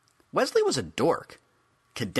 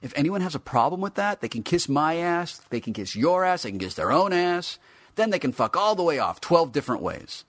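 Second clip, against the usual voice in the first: higher, louder swaggering tirade; defiant rant; 9 semitones higher, 6 dB louder than usual. A narrator's reading. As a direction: tell the haters off, building heat. Figure rants about critics.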